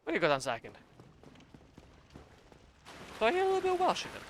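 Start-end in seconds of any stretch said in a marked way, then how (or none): 3.2-4.2 excited high squeal. Real, not acted. Mock gasp at Welsh-sounding NPC.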